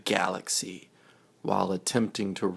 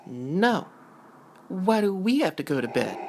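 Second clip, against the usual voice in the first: put-on much higher, fast childish protest; whiny child; 11 semitones higher, much faster than usual. Twins refusing their father's bedtime, whiny. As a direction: whine like a kid dodging bedtime.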